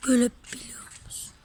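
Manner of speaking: hushed whisper, words breathed out. Real, not acted. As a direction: whisper it like sharing a secret. Kids exploring dark old factory.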